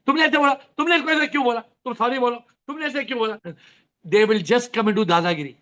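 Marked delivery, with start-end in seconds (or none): 0.1-3.4 high, fast, bossy scolding; meddling busybody. Retold or imitated. Busybody meddling in two people's quarrel, fast and high. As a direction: butt in and demand an apology. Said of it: "And non-enlightened person".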